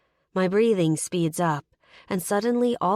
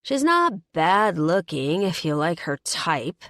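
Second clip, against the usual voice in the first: dry, louder put-down; dry comment; 5 dB louder than usual. A narrator's reading. Ex sizing up the new girlfriend, dry and louder. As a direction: toss it off dryly. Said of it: "Anita comments dryly".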